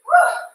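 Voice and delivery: cheer. Real, not acted. Cheering class mid exercise.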